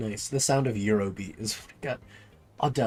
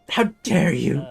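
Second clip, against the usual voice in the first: louder, indignant diva; offended diva; 4 semitones higher, 8 dB louder, slower than usual. Acted. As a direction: take offence like a spoiled star. Offended singer.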